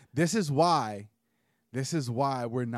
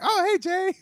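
excited fan voice; 7 dB louder than usual. Retold or imitated. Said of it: "they're like".

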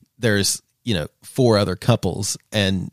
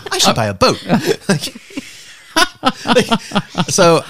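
laughing; 11 semitones higher, 5 dB louder than usual. Acted. Acting his overexcited self.

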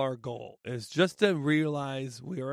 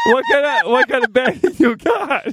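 squealing through laughter; can barely get the words out for laughing; 13 semitones higher, 11 dB louder than usual. Real, not acted. Laughing at offered bag.

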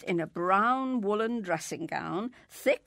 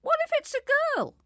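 put-on high childish voice; 6 dB louder, faster than usual. Child Mary questions the angel.

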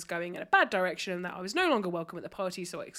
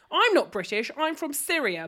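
prickly retort, pitched up; not very nicely; 6 semitones higher, 4 dB louder than usual. Retold or imitated. Party guest correcting a stranger's assumption, not very nicely. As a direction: curtly correct them, acting out the offended guest. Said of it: "not very nicely".

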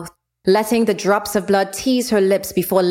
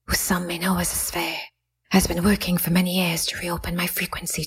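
hushed, unvoiced divine whisper; goddess whisper. Goddess Jael speaking.

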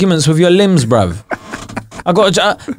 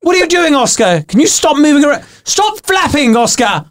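put-on higher, fussing father telling off a toddler; stern dad scolding; 6 semitones higher than usual. Dad telling his wandering arm Oscar off, high and stern.

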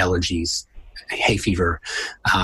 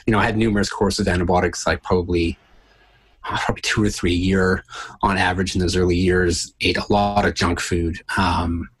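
ordinary talk

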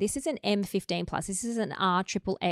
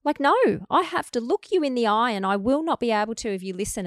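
high excited protest; 5 semitones higher, 5 dB louder than usual. Retold or imitated. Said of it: "And I'm like".

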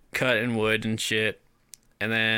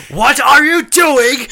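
hammed-up high, slow yell; really loud; 12 semitones higher, 11 dB louder, slower than usual. Voicing the film's argument myself, hammed-up loud yell. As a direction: yell it over laughter, high and drawn out. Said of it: "I thought that they did that really loud".

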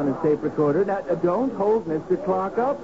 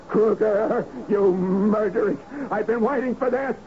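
building fury; 5 semitones higher than usual. Acted. Clark confronting the man named Kruger, building to a furious high cry. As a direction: let the hatred swell until it breaks.

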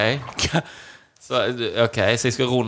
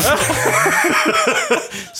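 shrieking laugh, much higher and louder; high burst of laughter; 5 dB louder than usual. Real, not acted. Cohost laughing hard at the nazi joke, high and loud.

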